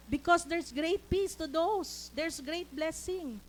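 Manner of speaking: emphatic proclaiming. Real, not acted. Preacher promising congregation great peace, slow emphatic proclaiming. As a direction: preach it slowly, each word driven home.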